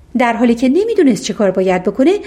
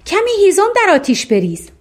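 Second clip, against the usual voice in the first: higher-pitched cry with little added volume; mild shout; 9 semitones higher than usual. A narrator's reading. A third voice orders wood on the fire, mild high cry. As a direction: call out the order, pitched up, only mildly shouted.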